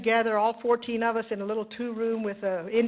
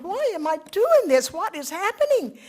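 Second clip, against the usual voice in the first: bewildered inner cry; 8 semitones higher, 4 dB louder than usual. Retold or imitated. Bewildered while weeping on rock.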